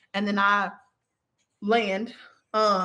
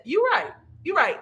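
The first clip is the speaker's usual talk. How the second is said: high-pitched, quick giving in; sheepish concession; 8 semitones higher than usual. Retold or imitated. Dreamer giving in to her scolding star guide, conceding.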